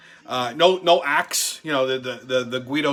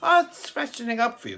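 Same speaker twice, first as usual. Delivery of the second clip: higher, sweet put-on girlfriend voice; mock cute girlfriend; 7 semitones higher than usual. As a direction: play innocent and cute after the bathroom. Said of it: "they're acting all cute".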